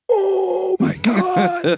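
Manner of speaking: high, drawn-out shocked cry; scandalized high-pitched wail; shocked reaction. Retold or imitated. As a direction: stretch out an astonished squeal. His shocked reaction to episode.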